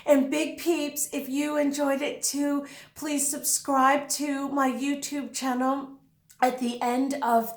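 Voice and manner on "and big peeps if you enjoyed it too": ordinary delivery, nothing marked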